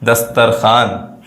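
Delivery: दस्तरखान drawn out as "dastar-khaan"; very slow दस्तरखान, no w sound; silent waw. Shown as a demonstration. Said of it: "वाव की आवाज़ नहीं आती है".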